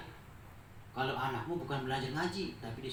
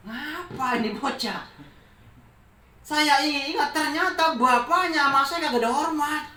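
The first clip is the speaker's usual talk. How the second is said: exasperated venting; 12 semitones higher, 9 dB louder than usual. Real, not acted. Venting about a rude parent.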